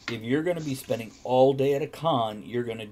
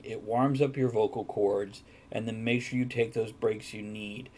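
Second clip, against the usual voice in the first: ordinary talk, nothing marked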